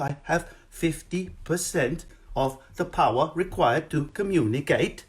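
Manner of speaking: mock Norwegian accent, halting sing-song. Shown as a demonstration. Comic showing how it's done, underpowered Norwegian accent. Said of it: "To do the Norwegian accent".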